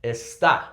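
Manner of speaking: "Está" with the beat on the last syllable, es-TÁ; stress on final syllable. Shown as a demonstration. Teacher shows what the accent does, "Está" stressed es-TÁ. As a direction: land firmly on the á of "Está". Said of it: "Because of the accent, we're gonna pronounce it".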